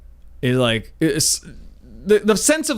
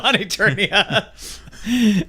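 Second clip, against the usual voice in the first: very slow, stretched dramatic repeat; slow drawn-out echo; 2 semitones higher, much slower than usual. Pretend. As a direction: drag each syllable out grandly.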